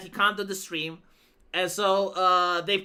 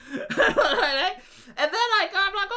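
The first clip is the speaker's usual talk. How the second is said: higher, laughing through the words; 9 semitones higher, 3 dB louder, slower than usual. Real, not acted. Telling viewers the story, laughing. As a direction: giggle out the lead-in.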